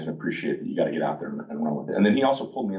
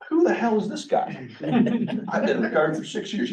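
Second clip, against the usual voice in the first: much higher, mock-indignant disbelief; higher startled voice, half-joking; incredulous; 14 semitones higher than usual. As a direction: replay the shock at the stranger's nerve. Retelling his shocked reaction.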